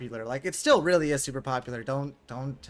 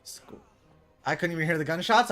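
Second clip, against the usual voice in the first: usual speaking voice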